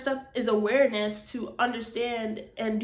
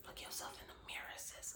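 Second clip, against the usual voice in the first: sudden soft whisper; 20 dB quieter than usual. Real, not acted. Urging her listeners to face the mirror, whispered. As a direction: lean in and whisper it like a secret. Repeats line, then whispers it.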